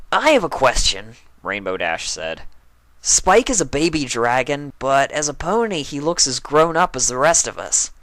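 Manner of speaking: high, loud sporty voice; loud, high-pitched tomboy; brash tomboy pony. Acted. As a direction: play it bold and curious. Rainbow Dash's voice.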